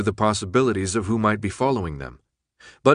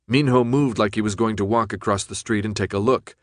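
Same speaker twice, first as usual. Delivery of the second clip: ordinary delivery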